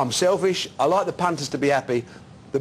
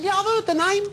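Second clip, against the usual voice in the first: much higher, squeaky mock voice; imitating Joe Bugner. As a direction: play the boxer small and squeaky. Bugner on the phone, retold.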